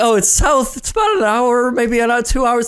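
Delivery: high-pitched, retold nervous rambling; imitating own flustered high voice; embarrassed rambling voice. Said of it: "this is the voice at that point".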